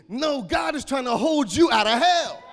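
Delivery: loud, high shouting sermon; full-force preaching. Acted. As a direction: preach it out loud to the whole church.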